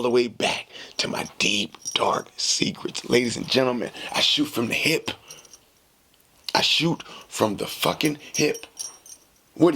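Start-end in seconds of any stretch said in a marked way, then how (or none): none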